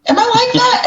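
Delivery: high, shocked question; startled self-question. Retold or imitated. Realising how she acts, high shocked question. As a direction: gasp it out in disbelief. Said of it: "And I'm like".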